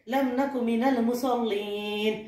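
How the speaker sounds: slow, drawn-out Arabic recitation; Quran recitation. Acted. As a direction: recite the verse slowly, holding the long vowels. Quran verse recited in the sermon.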